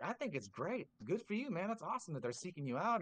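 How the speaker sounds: imitating a delighted coach cheering someone on; amused, approving coach. Coach Scott reacting to the job offer call, amused and approving. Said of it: "he just started laughing".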